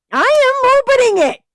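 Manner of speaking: very high, loud yell. A narrator's reading. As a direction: scream it defiantly. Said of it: "I yelled".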